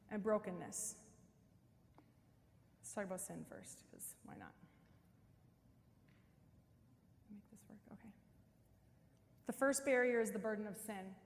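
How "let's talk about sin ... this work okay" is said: breathy whisper to herself; whispered aside. Real, not acted. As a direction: murmur it to yourself while fiddling with the target. Muttered aside while setting up props.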